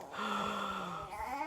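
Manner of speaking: long, soft acted gasp; acted-out gasp after a close call; retold gasp. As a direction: draw in a big quiet breath. Acting out relief in car.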